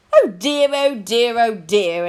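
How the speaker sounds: a touch of posh fluster; posh, starting high and loud, then settling. A narrator's reading. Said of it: "announced a posh voice".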